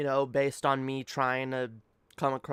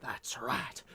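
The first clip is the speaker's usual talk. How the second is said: ordinary talk, nothing marked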